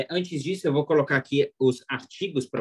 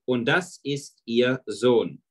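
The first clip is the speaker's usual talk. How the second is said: slow, each word stretched out; careful slow demo German; slow model reading; much slower than usual. Shown as a demonstration. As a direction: say it slowly for the class. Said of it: "este é o filho".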